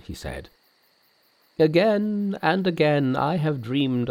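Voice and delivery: put-on high-pitched, awed old monk; reverent old priest. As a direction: recount the prophecy high, as an awed elder. Old priest recognizes Monkey.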